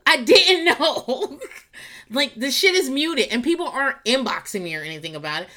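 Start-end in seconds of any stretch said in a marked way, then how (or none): 0.1-1.4 slow, drawn out, laughing mid-word; laughing through it. Real, not acted. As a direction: let the laugh break the words. Repeats it dissolving into laughter.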